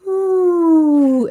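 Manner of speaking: high, loud drawn-out ooh; stunned together after the message; awed ooh. Retold or imitated. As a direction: gasp out an amazed ooh. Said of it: "We both were just like".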